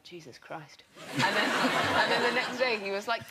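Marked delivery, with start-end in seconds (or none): 0.0-0.8 barely there, half asleep; sleepy mumble. Retold or imitated. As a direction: mumble it like you've just woken. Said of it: "he was kind of sleeping".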